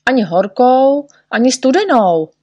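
Čiperka yells at Neználek, offended; indignant shout. A narrator's reading. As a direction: shout the denial, fed up. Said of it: "křičel Čiperka".

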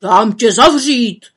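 loud, slow furious threat; angry threat. Acted. Forester threatening to lock the witch up, furious. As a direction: snap the threat at her. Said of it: "chtěl znovu zahubovat".

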